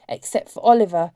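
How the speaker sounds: plain reading voice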